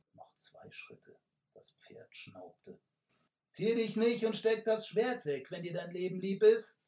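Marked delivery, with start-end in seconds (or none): 3.5-6.9 high, loud, menacing threat; hissed threat. Acted. Third robber threatens the messenger, hissing loudly. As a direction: threaten him with his life. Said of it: "zischte jetzt eine dritte Stimme".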